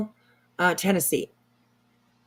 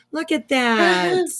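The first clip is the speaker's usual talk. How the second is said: gushing; 8 semitones higher, much slower than usual. Real, not acted. Gushing over a cute puppy.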